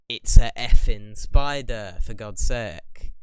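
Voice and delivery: loud, slow, disgusted rant at spiders; exasperated outburst. Real, not acted. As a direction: spit it out, fed up with spiders. Ranting about hating spiders.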